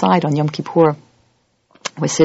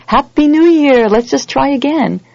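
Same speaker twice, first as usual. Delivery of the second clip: high, slow, sing-song "Happy New Year"; breezy and careless, like it costs nothing; mock breezy greeting; 9 semitones higher, 5 dB louder, slower than usual. To a congregation, mocking a careless New Year greeting. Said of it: "It's not enough just to say".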